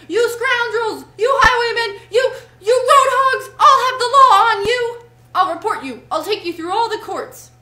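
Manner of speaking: high, very loud tirade, easing slightly at the end; furious shouting. A narrator's reading. Rat threatens the road hogs with the law, furious shouting. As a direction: roar threats at full force. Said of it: "simply transported with passion".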